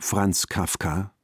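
normal talking voice

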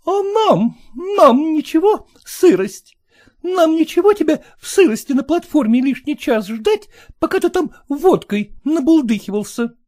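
high, loud indignant wife; angry wife. Acted. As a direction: fling it at him, outraged. Wife furious at husband.

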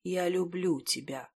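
soft, hushed love confession; whispering. A narrator's reading. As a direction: murmur it close to the face. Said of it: "шептал уже ей в лицо".

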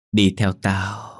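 very slow, drawn-out ghostly command; eerie doll summons. Acted. Possessed doll lures the girl away, eerie summons. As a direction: stretch it out like a haunting spirit luring someone.

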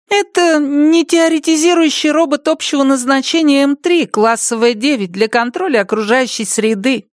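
put-on high child voice, offhand with pride; casual proud boy. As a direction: rattle off the name like a known fact. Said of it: "произнёс название небрежно, как общеизвестный факт".

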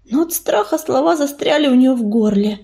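usual speaking voice

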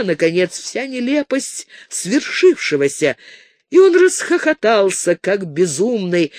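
usual speaking voice

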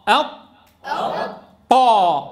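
أط shut dead, a hold, then a loud طا; doubled ta. Shown as a demonstration. Teacher drills the class, locked then released ط in طا. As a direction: brake on ط in أط, then burst into طا. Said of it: "بدنا ننطق طاء".

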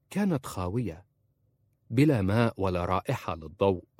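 plain reading voice

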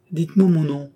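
the disguised man cries out his reveal; triumphant reveal. A narrator's reading. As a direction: lift the voice to unveil the secret. Crudge reveals himself triumphantly.

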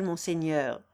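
usual speaking voice

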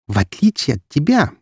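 a biting little jab; sarcastic. Acted. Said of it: "съязвила она".

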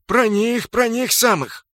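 high, loud, urgent reply; emphatic confirmation. A narrator's reading. Narrator confirms the Avengers are behind them, emphatic.

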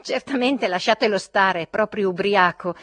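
disgusted. A narrator's reading. Trudolyubov telling the others to leave him, disgusted. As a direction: dismiss the drunkard with disgust. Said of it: "proferì Trudolyubov con disgusto".

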